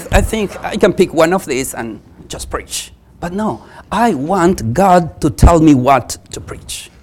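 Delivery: ordinary talk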